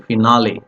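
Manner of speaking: "finale" as fi-NAH-lay; pronunciation ending in LAY. Shown as a demonstration. Teacher contrasting two pronunciations, this one ending in LAY. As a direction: end "finale" on a clear LAY. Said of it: "there are two pronunciation".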